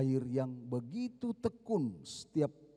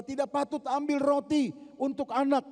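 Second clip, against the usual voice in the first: stern refusal; 12 semitones higher, 6 dB louder than usual. Acted. Jesus refusing the woman.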